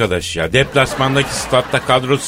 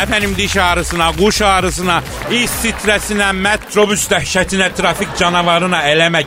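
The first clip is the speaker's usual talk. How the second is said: town crier; 4 semitones higher than usual. Acted. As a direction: hawk it like a bazaar crier. S0 opens show like a crier. (tellal gibi bağırarak)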